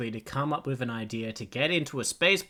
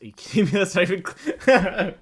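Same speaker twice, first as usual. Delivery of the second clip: loud, laughing, higher disbelief; 8 semitones higher, 12 dB louder than usual. Real, not acted. Streamer laughing at the AI's reaction. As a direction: crack up in disbelief.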